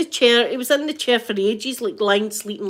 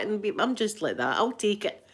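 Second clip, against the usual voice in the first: ordinary talk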